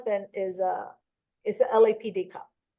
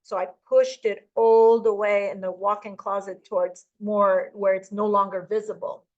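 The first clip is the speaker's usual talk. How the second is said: ordinary talk, nothing marked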